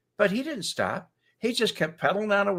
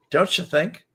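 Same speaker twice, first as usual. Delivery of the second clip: ordinary delivery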